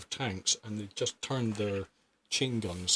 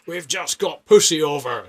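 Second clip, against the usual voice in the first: louder, higher radio call; louder repeat; 6 semitones higher, 12 dB louder than usual. Retold or imitated. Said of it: "I said".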